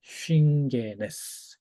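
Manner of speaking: 쉰게네스를 응 받침으로 천천히 또박또박. Shown as a demonstration. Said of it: "이렇게 읽어야 돼요". (쉰게네스를 느리게 또박또박 읽는 시범)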